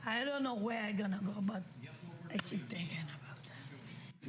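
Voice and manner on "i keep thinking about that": whispering. Real, not acted. Repeats worry after plain take.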